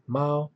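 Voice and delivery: "mao" held high and level. Shown as a demonstration. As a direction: say "mao" on a high, even first tone. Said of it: "the first tone sounds like this".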